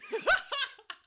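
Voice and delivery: loud high burst of real laughter. Real, not acted. Laughing at herself for confusing Chris Angel with Buck Angel in a loud burst. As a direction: crack up at your own mix-up. Laughs at own name mix-up.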